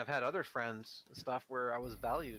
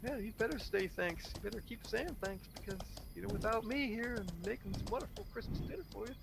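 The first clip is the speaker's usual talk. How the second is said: smug demanding host; 10 semitones higher than usual. Retold or imitated. Host demanding endless thanks from a lonely guest, high and smug. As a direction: make them grovel for the meal.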